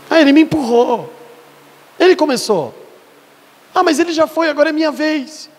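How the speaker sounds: imitando de leve criança reclamona, mais aguda; fala de criança. Acted. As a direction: queixa infantil encenada, em tom mais alto. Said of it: "tem gente que continua falando assim ainda". (imitação leve de menino fazendo queixa)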